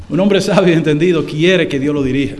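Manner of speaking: ordinary delivery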